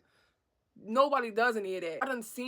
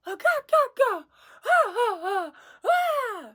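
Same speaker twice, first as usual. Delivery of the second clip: high, loud mock cackle; loud imitated guffaw; 10 semitones higher, 6 dB louder than usual. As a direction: imitate someone laughing far too loudly. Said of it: "laughing so loud".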